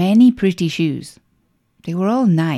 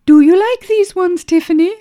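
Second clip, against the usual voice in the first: mother voice; 12 semitones higher, 5 dB louder than usual. Acted. Mother asking in shop.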